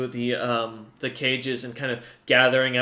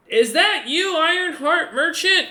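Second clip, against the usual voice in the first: much higher, louder, slow creature voice; kobold captain; 7 dB louder, slower than usual. Acted. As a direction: call out across the street as the little kobold. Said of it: "the kobold captain coming from the main street going".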